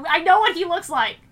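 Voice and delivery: loud, high exasperated outburst. Real, not acted. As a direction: blurt it out in disbelief. Reader exasperated at fic description.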